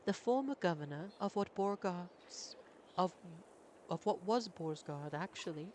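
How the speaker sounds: lightly voiced posh British prince; a hint of British accent. Prince addressing the governor in British accent. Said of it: "I can't change voices as quickly".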